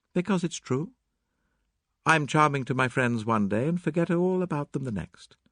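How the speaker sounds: high, matter-of-fact female character; Mary Cavendish. Acted. Mary admits being a bad friend, high candid voice.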